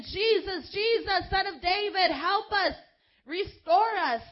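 high, loud pleading wail for help; desperate cry. Acted. Preacher acting the blind man calling to Jesus, desperate loud cry. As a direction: cry out like a blind beggar begging to be healed. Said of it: "the shout of the blind man".

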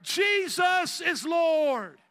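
slow, booming cry of faith; shouted proclamation. Real, not acted. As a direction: proclaim it at full force. Preacher's opening proclamation.

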